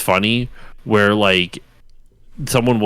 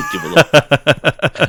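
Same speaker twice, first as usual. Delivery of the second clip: higher, slow words through laughter; laughing; 8 semitones higher, 5 dB louder, slower than usual. Real, not acted. Friend retelling a sketch's clap gag, laughing. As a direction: crack up while repeating the punchline. Laughs while repeating the punchline.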